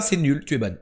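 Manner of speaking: ordinary delivery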